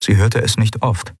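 ordinary delivery, nothing marked

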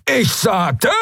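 high, cut-off shout; angry yell. A narrator's reading. Halbhorn turning on roaring Keru, angry yell. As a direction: start yelling, get cut short.

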